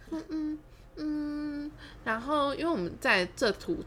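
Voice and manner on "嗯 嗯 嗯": high, slow, soft humming assent; feigned agreement. Retold or imitated. Humouring the man's warning, feigned agreement. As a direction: hum along obediently, drawn out.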